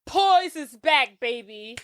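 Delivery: drawn-out mock victory cry; triumphant boss. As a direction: imitate a boss savoring a comeback. Acts boss celebrating the magazine's return.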